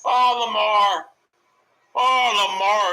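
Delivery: drawn-out jeering call of the name; slow, loud jeer at him; loud mocking call. Real, not acted. Jeering at Lamar on screen, drawn out and loud. Calling out at the player.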